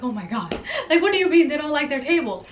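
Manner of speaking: exasperated, breaking into a laugh; laughing disbelief. Retold or imitated. Translator hearing the guests dislike their table, laughing exasperation. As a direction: laugh through the mock complaint.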